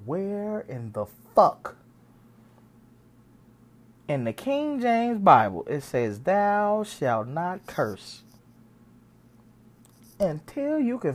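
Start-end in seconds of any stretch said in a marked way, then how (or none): 0.1-8.2 slow, deliberate, stressing each word; slow preachy challenge. Retold or imitated. Arguing with friends about cursing, slow deliberate challenge. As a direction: lay out the scripture question slowly. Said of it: "Listen to me now".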